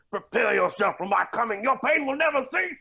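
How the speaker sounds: ordinary talk, nothing marked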